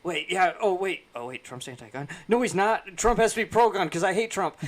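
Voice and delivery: loud, high, flustered mock voice; mock flustered Democrat. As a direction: sputter in loud confusion. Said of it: "the Democrats are saying".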